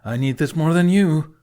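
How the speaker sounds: loud holler from the other end of the hall. A narrator's reading. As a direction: shout back from far away with a cheeky edge. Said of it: "Snotlout hollered from the other end of the hall".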